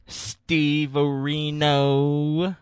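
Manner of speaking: slowly stretched announcer reveal; drawn-out announcement. Acted. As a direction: draw the name out for show. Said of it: "slow, drawn-out announcement".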